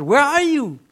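high, loud imitated cry for help; desperate plea. Preacher voicing David begging God for help. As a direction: call out to God like a drowning man. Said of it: "probably asking God".

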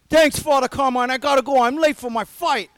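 hammed-up Rocky in a hurry; Rocky thanks. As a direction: rush off with a quick Philly thanks. Rocky voiced thanking priest.

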